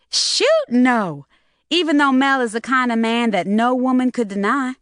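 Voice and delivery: high, loud startled denial; excited girl exclaiming. Acted. Loretta exclaims.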